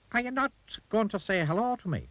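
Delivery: high-pitched little goblin. A narrator's reading. Goblin to rude Mister Uppity, high goblin voice. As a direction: speak well above your own pitch, as a goblin.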